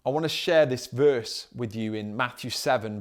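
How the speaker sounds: normal talking voice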